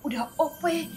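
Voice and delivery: ordinary talk, nothing marked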